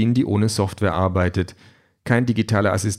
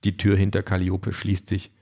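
ordinary delivery, nothing marked

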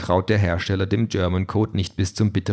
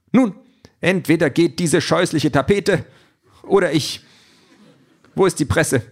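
higher, imperious android voice; haughty android ultimatum; 8 semitones higher than usual. A narrator's reading. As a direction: issue the ultimatum grandly. Calliope demands the press.

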